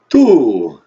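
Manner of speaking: put-on big Transylvanian accent with a long fall; Count. Count starting the count over, booming vampire accent. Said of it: "your vampire accent".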